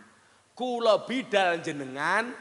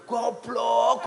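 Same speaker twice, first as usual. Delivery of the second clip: sharp, loud "Goblok," spat out high; self-scolding outburst; 6 semitones higher, 7 dB louder than usual. Retold or imitated. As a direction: snap "Goblok," at yourself, higher and louder. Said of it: "goblok ya aku". (nyeluk "Goblok," banter lan dhuwur)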